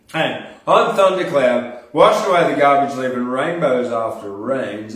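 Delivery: bold, slowed-down spoken-word opener; poem recital. Acted. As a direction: declaim it like a performance poet. Recites his own poem.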